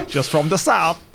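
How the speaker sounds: put-on Southern accent, amused; Alabama accent. Shown as a demonstration. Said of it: "I know how to do Alabama accent".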